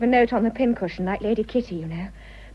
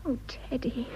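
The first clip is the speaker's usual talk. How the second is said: much softer, voiceless; whisper; 9 dB quieter than usual. Acted. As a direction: whisper his name tenderly. Tender whisper to lover.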